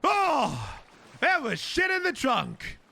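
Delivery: high, slow, amazed; drawn-out surprise; excited discovery. Real, not acted.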